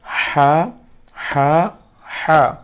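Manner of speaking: হা from the throat with a hiss; throaty হা drill. Shown as a demonstration. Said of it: "আমার গলা থেকে".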